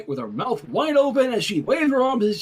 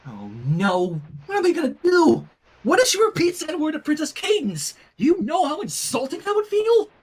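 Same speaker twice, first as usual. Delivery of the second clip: panicked worry; 7 semitones higher than usual. Acted. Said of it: "she covered her face".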